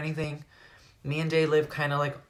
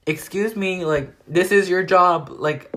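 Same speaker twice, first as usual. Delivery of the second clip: raised, sharp scolding at the driver; indignant retort; 5 semitones higher, 7 dB louder than usual. Retold or imitated. Teen scolding a mumbling Uber driver, indignant and loud. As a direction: call him out loudly for mumbling.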